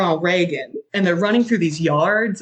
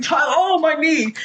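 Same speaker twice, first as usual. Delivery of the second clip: hammed-up pain; 9 semitones higher than usual. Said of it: "He's like".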